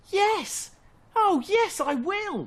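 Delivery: very high, loud delighted yes; overjoyed cry. Acted. Little fox answering Badger, overjoyed and very high. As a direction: burst out with joy. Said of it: "cried Fox".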